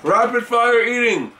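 talking between quick bites; chewing while speaking; mouth full of cereal. Real, not acted. Seen 2: Red-shirt speaker spoons cereal while talking; food visible in mouth at 1.2.

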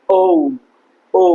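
ও as a gliding "ou"; corrected pronunciation. Shown as a demonstration. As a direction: let ও slide from "o" into "oo". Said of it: "এটা হবে".

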